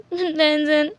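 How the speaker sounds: crying out a name. Acted. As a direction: sob out the name. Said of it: "wing जो कि रोने लगा".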